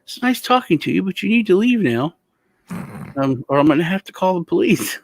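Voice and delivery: high, courteous but firm dismissal; mock polite grandpa. Grandpa politely orders the strangers to leave, high firm voice. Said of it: "he just stands there".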